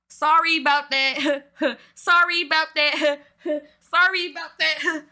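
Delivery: mock the lame apology while cracking up; repeated deadpan sorry broken by laughs; laughing mock reply.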